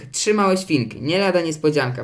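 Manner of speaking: plain reading voice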